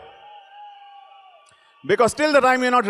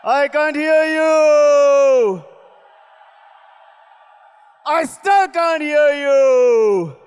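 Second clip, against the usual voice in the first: drawn-out stadium hype call; rally the crowd with a slow roar; booming hype shout; 5 semitones higher, 4 dB louder, much slower than usual. Real, not acted. Hyping crowd at event.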